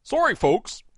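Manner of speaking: a hint of a goofy park mascot; lightly voiced cartoon moose announcer; Wally World Moose voice. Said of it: "I should do it in the Wally World Moose's voice".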